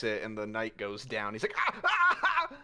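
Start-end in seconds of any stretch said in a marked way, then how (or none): 1.6-2.6 high, loud cries of pain; shrieking ah ah ah; pained yelp. Acted. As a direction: yelp as the wrist cracks. Knight's wrist gets cracked.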